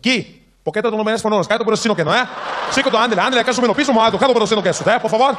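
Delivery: loud, high rapid-fire mock Spanish; mock Mexican. Airport cleaner accosting an arriving traveler, loud mock Mexican accent. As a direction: rattle off hammed-up Mexican Spanish, loudly. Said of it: "I was Mexican".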